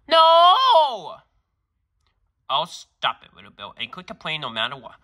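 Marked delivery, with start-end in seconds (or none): 0.1-1.2 put-on high, loud shrieking child's no; a child screaming in refusal; kid's shrieked no. Kid refuses principal's office.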